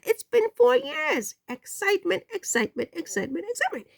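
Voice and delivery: sing-song the word over and over, trailing off; giddy excitement. Real, not acted. Repeating excitement, voice soaring.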